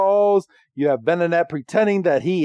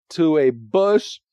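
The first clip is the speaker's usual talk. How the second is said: ordinary talk, nothing marked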